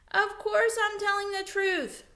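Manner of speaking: high and loud, indignant. Acted. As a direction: bristle at being doubted. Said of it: "Ralph was indignant".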